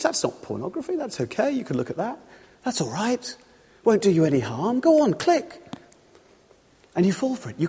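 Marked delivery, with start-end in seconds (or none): 0.0-5.6 wheedling, with a high final nudge; tempting devil. Acted. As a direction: reassure lightly, then egg them on. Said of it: "The devil says".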